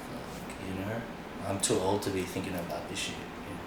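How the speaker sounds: soft, weary, nearly voiceless; tired mutter. Real, not acted. Tired dancer muttering about the scene, softly. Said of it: "weary aside".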